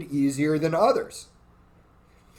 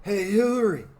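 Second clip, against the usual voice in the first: bad Bill Clinton impression; 4 semitones higher than usual. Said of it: "I can't even do a good accent".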